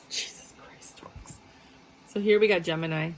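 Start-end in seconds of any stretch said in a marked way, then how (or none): 0.1-1.4 hushed exasperated whisper under breath; whispered aside. Real, not acted. Reader whispers to herself at the Taurus cards, exasperated. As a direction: breathe it out in disbelief.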